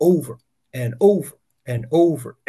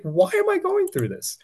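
pleading high cry of why me; a desperate, high-pitched why; anguished question. Retold or imitated. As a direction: ask it high, pained and lost. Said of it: "It was like".

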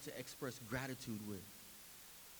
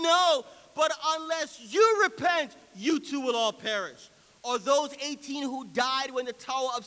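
raised voice building to a thundering "no"; forceful scripture reading; 10 semitones higher, 14 dB louder than usual. Real, not acted. Preacher reading Jesus' call to repent, at full force. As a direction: proclaim it louder and higher as it builds.

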